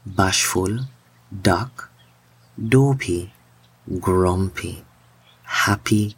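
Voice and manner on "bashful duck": usual speaking voice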